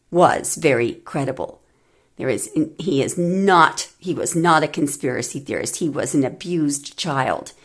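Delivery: normal talking voice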